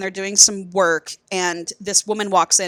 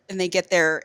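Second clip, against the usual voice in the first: ordinary talk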